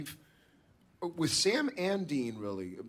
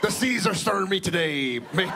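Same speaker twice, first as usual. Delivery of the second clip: loud, high hammy pirate shout; 3 semitones higher, 3 dB louder than usual. Retold or imitated. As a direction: bellow it like a sea captain. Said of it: "make me sound like a pirate".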